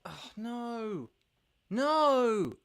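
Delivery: high, rising alarm into a loud cry of no; real dismay as the screen dies. Real, not acted. Streamer sees computer fail, cries out in dismay.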